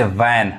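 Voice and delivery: वैन with an open 'ae'; taught vowel. Shown as a demonstration. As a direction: say वैन as 'van'. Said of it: "ए sound पढ़ता है". (वैन में 'ऐ' की आवाज़ बोलें)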